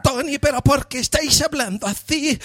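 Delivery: high, sing-song moaning mock Spanish woman; imitando españolas de película. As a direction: whine it high, as if overcome with pleasure. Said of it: "hablan así como si se las". (española de película gimiendo, muy agudo)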